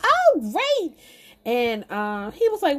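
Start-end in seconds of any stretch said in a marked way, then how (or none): 0.0-1.0 very high-pitched, overdone enthusiasm; mock gushing. He hears about her website, mock gushing. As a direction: imitate an over-eager man, way up high.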